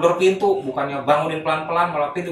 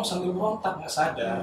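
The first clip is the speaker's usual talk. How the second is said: ordinary delivery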